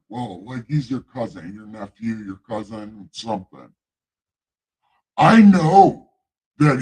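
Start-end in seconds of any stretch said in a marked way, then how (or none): 5.2-6.8 loud god. Acted.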